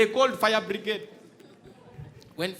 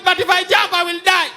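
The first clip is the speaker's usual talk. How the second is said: high, loud panicked cry; panicked shout; 10 semitones higher, 9 dB louder than usual. Retold or imitated. Trapped man to the officer below, a high loud panicked shout. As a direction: plead down from the ledge.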